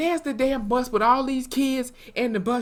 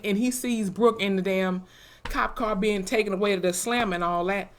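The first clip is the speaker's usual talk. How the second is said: ordinary delivery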